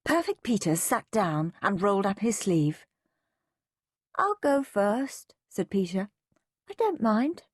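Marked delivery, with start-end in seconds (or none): 4.1-5.4 put-on high, slow child's voice; volunteer slowly in a high little voice; goody-goody brother, drawn out and high; obliging little boy. A narrator's reading. 6.7-7.4 put-on high-pitched child's voice; goody-goody brother's high little voice; obliging little boy. A narrator's reading.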